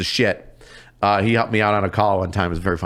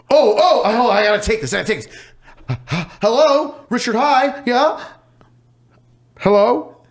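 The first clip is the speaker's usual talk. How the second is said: pitched way up, flustered and eager; nervous high-pitched hello into the phone; frantic high excitement; 11 semitones higher, 3 dB louder than usual. Retold or imitated. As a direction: scramble to answer the big call, voice cracking high. Frantic answering of blocked call.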